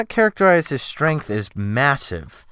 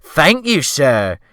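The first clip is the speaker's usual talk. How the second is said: high, louder gush of humble thanks; humbly grateful cook; 4 semitones higher, 6 dB louder, slower than usual. A narrator's reading. The cook thanking the gentleman for a tip, humbly grateful. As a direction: melt with gratitude at the promised tip, voice jumping higher. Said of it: "very gratefully and very humbly indeed".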